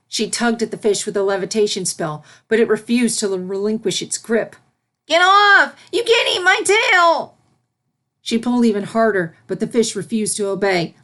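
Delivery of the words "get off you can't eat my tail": high, loud frantic yell; panicked yelling. Acted. Filly yelling at the fish biting her tail. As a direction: shriek at the fish to let go.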